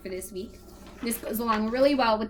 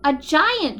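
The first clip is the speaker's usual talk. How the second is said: louder, higher startled shout; 6 semitones higher, 7 dB louder than usual. Acted. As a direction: yell it in alarm. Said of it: "George shouted".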